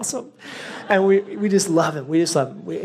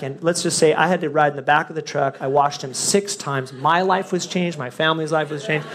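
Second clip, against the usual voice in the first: ordinary delivery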